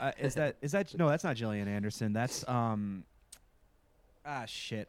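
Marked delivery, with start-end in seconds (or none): 2.1-4.9 hungover. Real, not acted. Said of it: "I'm so hungover".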